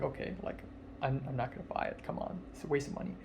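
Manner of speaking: fast, soft whispered reasoning; whispered self-talk about money; inner thought. Retold or imitated. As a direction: mutter to yourself, talking yourself out of it. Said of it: "originally I'm like".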